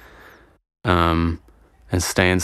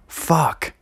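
high pitched exasperated fuck; sharp mock-annoyed curse; 8 semitones higher than usual. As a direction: spit it out in frustration. Exasperated outburst.

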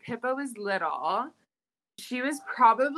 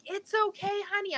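high, soothing reassurance; calm the child while running; soothing mom; 10 semitones higher, faster than usual. Retold or imitated. Mom quoting herself hushing her daughter, high soothing voice.

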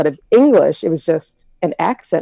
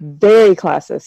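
"very" drawn out slowly with weight; emphatic repeat; 3 dB louder than usual. Real, not acted. Explaining to the host how Jamaica is, emphatic. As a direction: lean hard and slow on "very".

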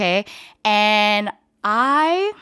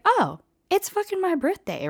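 mock delight; 11 semitones higher than usual. Voicing her own surprised thought.

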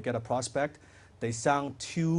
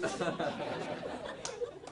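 laugh; 3 semitones higher, 12 dB quieter than usual. Acted. Mocking his own eager salesman.

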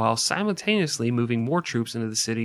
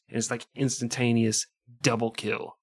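normal talking voice

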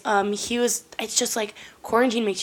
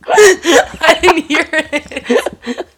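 high, squealing through laughter; cracking up, words stretched out by laughing; helpless laughter; 7 semitones higher, 7 dB louder, much slower than usual. Real, not acted. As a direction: barely get it out for laughing. Co-host just soiled pants on mic.

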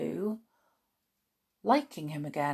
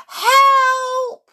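high, loud, drawn-out cry for help; screamed; 11 dB louder than usual. A narrator's reading. Mrs Jenkins terrified of the mouse, screaming. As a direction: scream it out in terror. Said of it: "screamed Missus Jenkins".

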